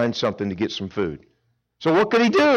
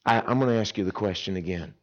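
ordinary talk

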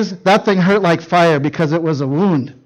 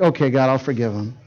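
quiet, lower, sincere yielding; softened surrender; 7 semitones lower, 6 dB quieter than usual. Retold or imitated. Father yielding to God at last, quiet and sincere. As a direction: let it go gently, at peace. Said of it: "as it kind of worked in my heart".

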